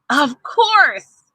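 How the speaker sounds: put-on excited boy says yes; eager yes. A narrator's reading. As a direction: jump at the chance. Tony eager to help.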